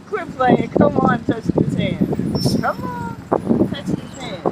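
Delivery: high playful calls mixed with whisper; playful coaxing. Real, not acted. Playing with the tree like a pet, teasing coax.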